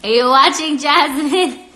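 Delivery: loud, high sing-song call to a viewer. Real, not acted. Calling out to Jasmine who is watching, loud and playful. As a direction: call her name out playfully.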